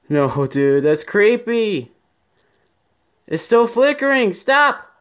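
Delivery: real panic, voice jumping high; terrified horror victim. Real, not acted. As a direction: pitch shooting up in real fright, then a pleading stop. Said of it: "Dude, I'm scared".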